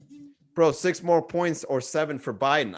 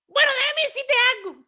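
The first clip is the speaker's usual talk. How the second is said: put-on much higher, fast old lady voice; old lady retort; 3 dB louder, faster than usual. The old Cuban lady snapping back, high and fast. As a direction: fire back like an indignant older lady.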